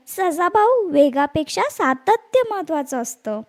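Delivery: ordinary delivery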